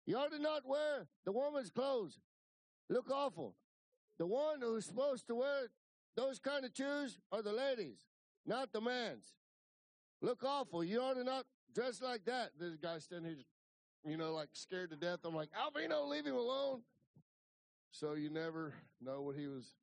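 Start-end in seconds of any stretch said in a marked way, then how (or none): 0.0-12.5 very high, loud scolding; imitating Alvino. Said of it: "He goes". 15.5-16.8 high, loud retold protest; acted urgent plea. Acting out reining in his friend.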